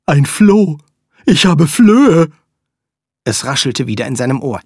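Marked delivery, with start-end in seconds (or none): 0.1-2.3 loud, high startled cry; shocked panic at the discovery; alarmed outburst. Acted. Dog discovers a flea.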